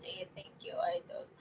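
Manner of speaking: hushed goodnight thanks; whispered thanks. Real, not acted. Signing off to a viewer.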